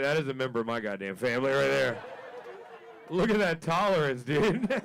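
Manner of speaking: loud, high, laughing brag; proud shout. Retold or imitated. Proud owner boasting to the crowd, loud and high. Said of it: "I was like".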